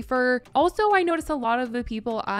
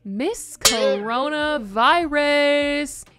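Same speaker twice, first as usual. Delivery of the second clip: loud, higher, drawn-out announcer call; mock pageant announcer; 5 semitones higher, 6 dB louder, much slower than usual. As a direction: announce the star with a slow, big flourish. Mock announces convention's star.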